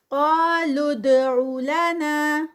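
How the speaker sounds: slow chanted, heavy ق and qalqala on د; Quran recitation. Shown as a demonstration. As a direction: recite drawn out. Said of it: "قا heavy".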